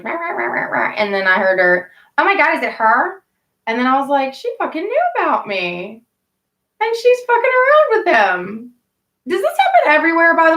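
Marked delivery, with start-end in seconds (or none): 0.0-0.9 high mock yapping of the other girl; obnoxious yapping. Mocking the other girl's voice, high yapping rah rah. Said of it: "her obnoxious voice". 2.2-3.2 mock other girl. Other girl realizing she came. 4.3-8.7 rising into a high, indignant cry; outraged. Retold or imitated. Telling viewers how the other girl betrayed her, high outraged.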